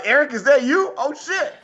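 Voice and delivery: high-pitched, startled double take; mock amazement. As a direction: act out being completely fooled. Retells mistaking impression for Eric.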